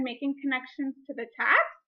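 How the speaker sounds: usual speaking voice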